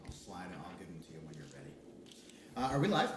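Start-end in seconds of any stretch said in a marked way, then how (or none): 0.0-1.7 fast soft whisper. Real, not acted. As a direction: murmur it quickly under your breath. Whispering aside about maps.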